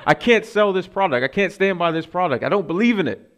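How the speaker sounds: high, loud retold refusal; mock indignant rant. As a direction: act out refusing to endorse it. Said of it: "he storms out".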